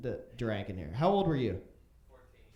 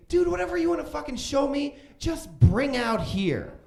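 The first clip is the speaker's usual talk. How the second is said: put-on high, loud exasperated teen girl; annoyed sister; 10 semitones higher, 6 dB louder than usual. Sister yelling back from the party, fed up. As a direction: drag out the last words, fed up. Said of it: "she said to me".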